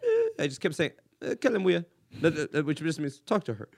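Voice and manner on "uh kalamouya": higher, clumsy beginner's Arabic; broken Arabic. Retold or imitated. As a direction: imitate your own broken Arabic. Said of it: "my broken Arabic".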